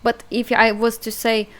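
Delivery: usual speaking voice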